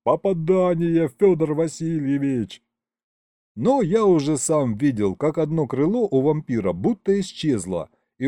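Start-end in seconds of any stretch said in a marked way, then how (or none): 0.1-2.6 high, elated report; raised, jubilant cry; triumphant yell. A narrator's reading. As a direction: call out the hit in triumph. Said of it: "завопил Колымдай".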